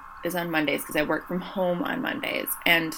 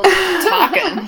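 high, slow laughing words; 13 semitones higher, 9 dB louder than usual. Real, not acted. Joking about the podcast's listeners.

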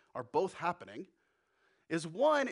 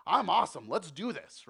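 cocky Peter; 6 dB louder than usual. Acted. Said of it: "He sees".

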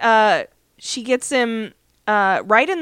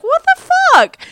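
high, loud mock outburst; retold shock; 9 dB louder than usual. Colleague learning there's a man in the trunk, high loud acted shock. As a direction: exclaim it in loud, high-pitched disbelief.

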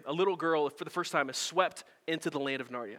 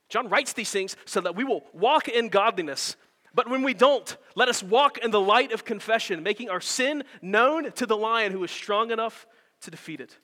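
louder and higher, preaching with force; impassioned preaching; 5 semitones higher, 5 dB louder than usual. Acted. Pastor urging the church to confess, preaching at full force. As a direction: drive the exhortation home to the congregation.

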